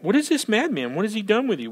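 baffled questioning. Acted. Acting the baffled officers' questions.

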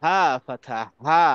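breathy throat হা for the class; throat h sound. Shown as a demonstration. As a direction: push হা from inside the throat. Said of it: "এটা ভিতর থেকে উচ্চারণ হবে".

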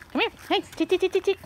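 high, loud clucking call; sing-song call to the hens; calling chickens. Real, not acted.

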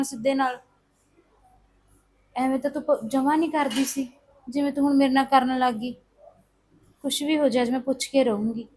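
softly resolving to herself, under her breath; quiet self-talk. Acted. Young woman alone, resolving to question her sister-in-law, murmured. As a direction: talk yourself into a confrontation, quietly.

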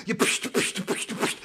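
breathy stab sounds; unvoiced stabbing psh. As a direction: spit out little stabbing noises. Imitating knife stabbing noises.

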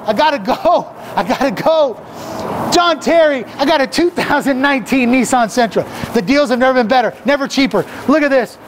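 car ad pitch at full throttle, racing the clock; hyped car-dealer pitch. Acted. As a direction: shout the deals like a manic auctioneer. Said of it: "I got a little excited out there".

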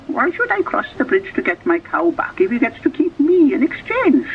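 put-on high, wary old woman's voice; old woman doubting the devil's deal; suspicious old woman. A narrator's reading.

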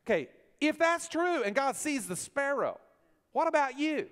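high and loud, pressing the question; preaching loud. Real, not acted. Preacher challenging the congregation, loud and high. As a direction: challenge the crowd.

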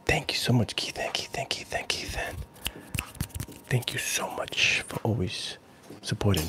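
soft, unvoiced ASMR thanks; ASMR whisper. Real, not acted. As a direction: whisper gratefully into the mic.

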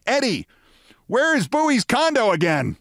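high, hollering call; Steinbrenner. Retold or imitated. Steinbrenner calling back to Eddie about the condo, high holler. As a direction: pitch it way up and call across the street. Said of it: "suddenly I heard Steinbrenner say".

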